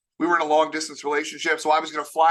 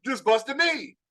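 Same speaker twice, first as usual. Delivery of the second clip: loud mocking call; crowd calling out; 6 semitones higher, 4 dB louder, much slower than usual. Classmates at prom calling out to mock him, loud. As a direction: call it out across the room.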